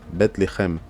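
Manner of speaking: Bethléem as "bet lekhem", with throaty kh; Hebrew pronunciation. Shown as a demonstration. Teacher repeats the Hebrew name for learners, Hebrew pronunciation of Bethléem. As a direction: pronounce Bethléem in Hebrew, kh in the middle. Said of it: "en hébreu, ça se dit".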